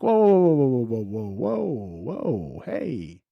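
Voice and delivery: rising, loud string of whoas to cut her off. Retold or imitated. Telling his panicking wife to slow down, loud rising whoas. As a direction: hold up, hold up, stop her right there.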